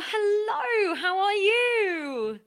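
high, drawn-out singsong welcome; gushing host greeting. Acted. Host opening door to second guest, gushing. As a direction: sweep up high, then slide down slowly.

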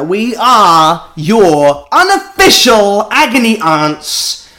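slow, punchy mock-grand announcement; show intro. Host grandly welcomes listeners to agony-aunt show, mock-grand intro. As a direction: draw out and punch each adjective.

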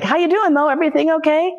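very high, chirpy imitation; mock friendly check-in. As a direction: switch to a sweetly casual concern. Tormentor suddenly checks in after scene.

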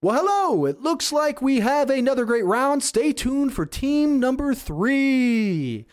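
very high, loud, slow stadium announcer. Acted. Stadium announcer calling the next round, very high and loud. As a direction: boom it out like a game-show host. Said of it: "the announcer comes back over and goes".

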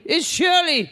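indignant [name]. Retold or imitated. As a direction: insist on the name, offended. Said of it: "doing a [name] impersonation".

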